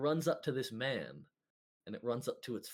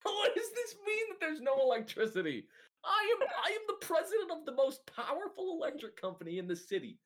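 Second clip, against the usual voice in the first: very high, squealing indignation; a pompous boss losing it in disbelief; shrieking president; 13 semitones higher, 5 dB louder than usual. Acted. A company president squeals at the players, very high.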